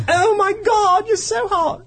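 high-pitched mock swooning girl; smitten woman. Mimicking women smitten by an Australian's accent, high swooning falsetto. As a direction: put on a squeaky English tea-lady voice. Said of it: "eighty-year-old English tea people".

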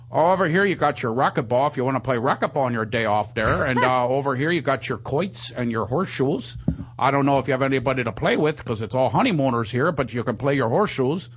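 put-on casino boss's local Pocono accent. Caesars boss giving a tour of the place, Pocono accent. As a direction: show someone round in a thick Pocono accent. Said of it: "uh, Pocono accent".

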